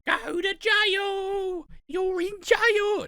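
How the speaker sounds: screaming mum. Retold or imitated. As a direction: screech it out like a furious mum at Monopoly. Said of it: "She is that fucker going".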